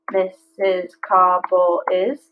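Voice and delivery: slow, each syllable spaced out; slow syllable-counting read. Shown as a demonstration. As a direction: clap out each beat. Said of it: "that's five".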